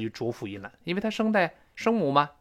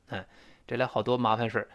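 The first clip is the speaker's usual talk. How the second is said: normal talking voice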